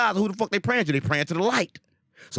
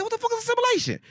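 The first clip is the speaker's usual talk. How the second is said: much higher, incredulous retold question; baffled question. Retelling his own confused reaction, high and baffled. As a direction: squeak it out in disbelief. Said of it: "And I said".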